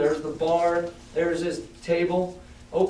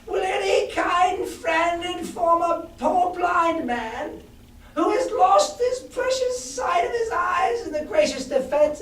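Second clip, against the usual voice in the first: a much higher, drawn-out sing-song shout; odd sing-song; 14 semitones higher, slower than usual. Acted. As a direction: call out to the empty air like a blind beggar pleading. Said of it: "raised his voice in an odd sing-song".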